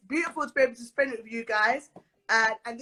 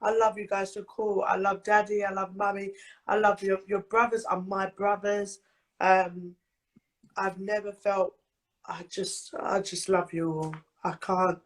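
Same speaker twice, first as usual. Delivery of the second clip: lower, tearful birthday thanks; choked-up crying; 7 semitones lower than usual. Real, not acted. Birthday girl thanking her best friend, crying. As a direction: push the love out through tears. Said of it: "Bloody crying, woman".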